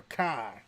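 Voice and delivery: "car" said the Boston way, with no r and a long open ah; Boston pronunciation. Shown as a demonstration. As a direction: drop the r in "car", Boston style. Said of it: "where they pronounce car".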